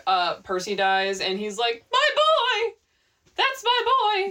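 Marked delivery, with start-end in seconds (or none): 1.9-4.3 high, loud grieving cry of a father; anguished dad wail. Retold or imitated. A father howling over his son's body, loud and high. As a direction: howl it like a father finding his dead son.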